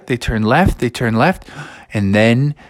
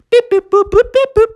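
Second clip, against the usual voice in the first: high robot beeps; 5 dB louder than usual. Acted. Robot bear's screen noises.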